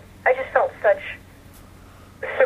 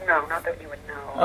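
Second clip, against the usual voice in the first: softer, lower discreet denial; discreet dodge; 4 semitones lower, 5 dB quieter than usual. Real, not acted. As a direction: drop lower and softer to close the subject. Repeats the dodge, closing the subject.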